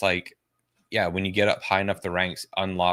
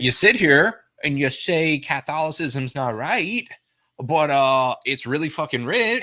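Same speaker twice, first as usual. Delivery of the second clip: high, drawn-out mock whine of an apologist; mocking defender; 9 semitones higher, 5 dB louder, slower than usual. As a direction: parody a lame excuse, stretched out and pitched up.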